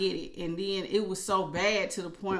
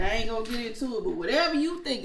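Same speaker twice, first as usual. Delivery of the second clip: normal talking voice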